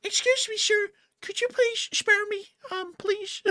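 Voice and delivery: tiny, very high squeaky plea; high cartoon shrimp pleading to be spared; begging shrimp. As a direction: beg for mercy like a terrified little shrimp. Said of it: "The one that was like".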